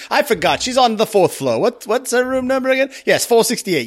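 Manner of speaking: high, eager helpful clerk; chirpy clerk. Retold or imitated. A clerk blurting a guest's room number, high and eager.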